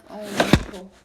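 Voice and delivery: panicked cry. Retold or imitated. Boy at the hospital, loud panicked cry. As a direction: blurt it out in alarm.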